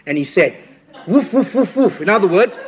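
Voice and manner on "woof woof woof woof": imitating a monk barking, half laughing; mock barking. Retelling how the chain-smoking monk barked at him, mock barking with a laugh. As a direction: bark it out playfully with a laugh. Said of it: "he barked".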